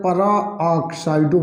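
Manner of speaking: peroxido stretched slowly across its syllables; drawn-out term. Shown as a demonstration. Teacher answering how the ligand is called, drawn out. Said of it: "क्या बोला जाए?".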